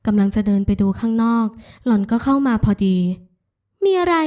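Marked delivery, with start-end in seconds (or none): 3.4-4.1 higher-pitched, irritated question. A narrator's reading. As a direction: ask it crossly in a raised pitch. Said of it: "หล่อนถามเสียงขุ่น". (ถามเสียงขุ่น เสียงสูงขึ้น)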